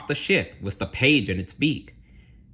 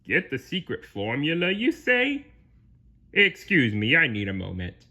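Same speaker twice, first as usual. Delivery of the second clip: raised, scheming character voice; Plankton musing; 6 semitones higher than usual. Acted. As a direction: rise into an interested question. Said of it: "Plankton mused".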